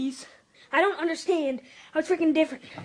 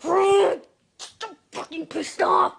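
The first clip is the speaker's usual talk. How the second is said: angry rant; 3 semitones higher, slower than usual. Real, not acted. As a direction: blurt it out in anger. Kid ranting angrily at camera.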